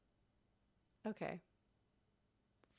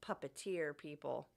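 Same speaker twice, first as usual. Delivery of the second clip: ordinary talk, nothing marked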